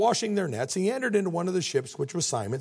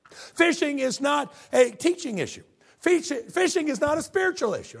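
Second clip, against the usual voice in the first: high-pitched, sarcastic protest; exasperated rant; 8 semitones higher, 4 dB louder than usual. Acted. Peter telling Jesus fishing is his business, exasperated. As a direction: complain loudly, as if lecturing someone out of his field.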